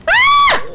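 high, loud cartoon shriek; Homer Simpson scream. Real, not acted. Player screaming, then comparing it to Homer Simpson. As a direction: let out a sudden panicked shriek. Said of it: "I scream like Homer Simpson, don't I?".

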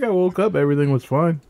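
high-pitched teasing imitation; mock disappointed. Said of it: "Kinda disappointed".

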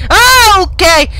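loud, high cry of surprise; real startled outburst; startled shout. Real, not acted. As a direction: yelp in sudden shock. Startled on entering bedroom.

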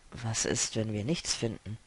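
low, soft doubtful question; hushed, low-pitched worry; subdued doubt. Acted. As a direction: ask it quietly, voice dropped. Akos voices doubt to Lennis.

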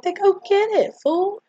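high, loud mock-indignant comeback; mocking retort. Friends snapping back at Smalls.

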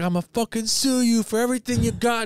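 high-pitched, outraged whine; mock furious threat. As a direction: threaten in a hammed-up squeal. Acting the hit man's threat.

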